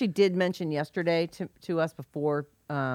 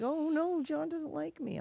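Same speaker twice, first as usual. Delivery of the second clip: higher, soft little whine of dismay; mock worried; 9 semitones higher, 10 dB quieter, slower than usual. Co-host voicing people whose texts go unanswered, mock worried. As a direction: imitate a hurt, fretting friend. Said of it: "they feel like".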